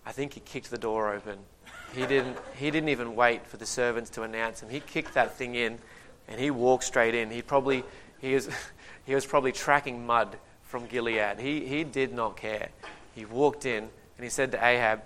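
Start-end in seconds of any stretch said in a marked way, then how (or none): none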